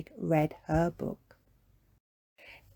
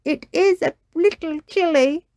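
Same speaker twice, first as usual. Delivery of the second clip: high, slightly louder shivery line; lightly gasped; 10 semitones higher, 5 dB louder than usual. A narrator's reading. Mummy in the cold sea, a light shiver. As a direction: say it lightly chilled. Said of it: "She gasped".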